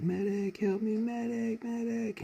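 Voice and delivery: high, pleading calls for the medic; wounded soldiers calling. Retold or imitated. Hypnotists faking wounded soldiers, high pleading calls. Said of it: "him and a couple other people pretend to be, uh, people screaming".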